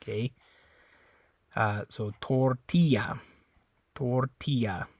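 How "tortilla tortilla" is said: "tortilla" slow, I as E, LL as Y; Spanish tortilla. Shown as a demonstration. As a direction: say "tortilla" as tor-TEE-ya. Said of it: "the I always makes a E sound".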